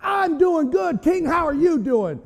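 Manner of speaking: high, exuberant preacher's shout; triumphant shout. Acted. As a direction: crow it back to the king, full of confidence. Daniel's triumphant shouted answer.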